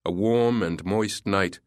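ordinary delivery, nothing marked

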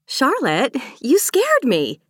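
high-pitched cry of fright; startled exclamation. A narrator's reading. Maeve to the friend who scared her, startled exclamation. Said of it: "Maeve exclaimed".

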